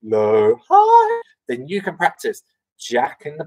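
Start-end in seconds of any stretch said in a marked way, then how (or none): 0.0-0.6 low voice. Shown as a demonstration. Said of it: "from low up to high". 0.7-1.2 high voice. Shown as a demonstration. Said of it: "from low up to high".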